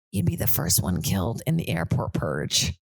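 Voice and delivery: deadpan verdict. Acted. Mock verdict on other travelers.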